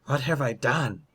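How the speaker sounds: voiced anguish, pitch pushed up; anguished realization. Werewolf seeing blood on his hands, anguished. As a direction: cry it out in horror at yourself. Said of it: "and Plim says".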